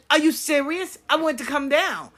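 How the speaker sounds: indignant protest. Retold or imitated. Retold grandson protesting being left out.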